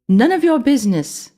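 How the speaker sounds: high, loud defiant line with a shaking voice; quivering defiance. A narrator's reading. Ellie defies the rat while terrified, quivering. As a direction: fake bravery that isn't working. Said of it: "Her voice was quivering".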